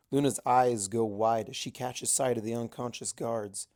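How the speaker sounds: usual speaking voice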